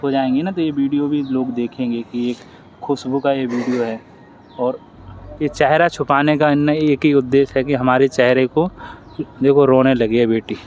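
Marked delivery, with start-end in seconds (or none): none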